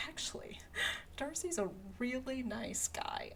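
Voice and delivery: whispered aside in church; a hushed pew-side whisper. Retold or imitated. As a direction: lean in and whisper it during the sermon. Whispered aside during the sermon.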